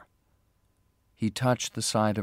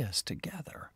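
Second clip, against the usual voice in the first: whisper; 13 dB quieter than usual. A narrator's reading. Whispered end of plea.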